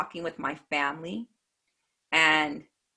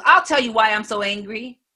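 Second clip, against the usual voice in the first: loud, higher-pitched imitated anger; angry retort; 4 semitones higher, 8 dB louder than usual. Angry heckler about to tell his story, angry retort. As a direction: insist angrily, acting out the heckler. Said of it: "Why are you so angry?".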